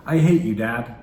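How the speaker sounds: put-on angry child shout. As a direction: blurt it out like a furious kid. Child's outburst acted out.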